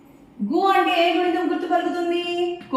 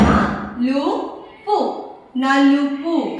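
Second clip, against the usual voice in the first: slow syllables; much slower than usual. Shown as a demonstration. Teacher blending syllables slowly for pupils. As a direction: sound out each syllable slowly. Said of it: "కలిపి చదవండి".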